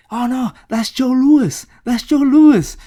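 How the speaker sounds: high, loud mock alarm; hammed-up crowd reaction, pitch jumping up; alarmed exclaiming. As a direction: call out like an astonished onlooker. Onlooking soldiers react in alarm.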